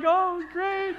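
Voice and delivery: high, loud answer to a greeting; startled friendly reply. Retold or imitated. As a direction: play along with a pushy stranger. Passers-by answer the stranger.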